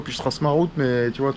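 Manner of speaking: ordinary talk, nothing marked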